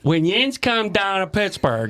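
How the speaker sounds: slow, broad Pittsburgh dialect; Pittsburgh Yinzer accent. Shown as a demonstration. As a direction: lay on a drawn-out Yinzer accent. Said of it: "there's a whole Yinzer dialect".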